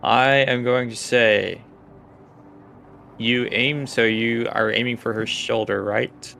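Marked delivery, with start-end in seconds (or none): none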